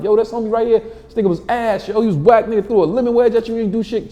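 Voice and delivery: high-pitched mocking bystanders; departing audience ridicule. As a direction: ridicule him in a high put-on voice. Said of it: "people walking out like".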